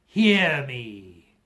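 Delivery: loud, commanding call; ghost's cry. A narrator's reading. Marley's ghost crying out to Scrooge, loud and commanding. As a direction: demand to be heard. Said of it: "cried the ghost".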